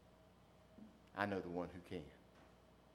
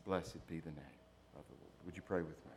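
hushed blessing; 9 semitones lower, 10 dB quieter than usual. Real, not acted. Quiet benediction.

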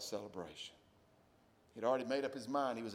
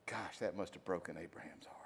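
hushed, breathy whisper; 4 semitones lower, 7 dB quieter than usual. Real, not acted. Pastor whispering about Abraham's grief. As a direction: whisper it in awe of his grief.

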